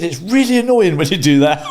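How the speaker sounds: hammed-up impression of a fed-up wife; imitating wife. Wife telling him he never takes the bait, high exasperated impression. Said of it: "She said".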